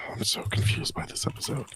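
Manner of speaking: whispered, baffled aside; hushed voiceless grumble of confusion; confused whisper. Real, not acted. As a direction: whisper it under your breath, lost. Confused aside under the breath.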